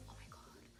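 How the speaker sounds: breathless whisper of delight; hushed oh my god; whispered awe. Real, not acted. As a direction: whisper it, stunned. Awed at the gift.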